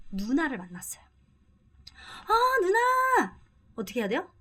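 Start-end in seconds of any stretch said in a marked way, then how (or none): 2.3-3.4 high, slow excited hail; excited call. Acted. Minki spots older sister on street, excited high call. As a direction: spot her and call out happily. Role-played street greeting.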